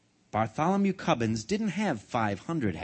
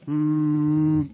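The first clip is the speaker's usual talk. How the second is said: alarm clock hum; 6 dB louder than usual. Acted. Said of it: "his alarm clock goes".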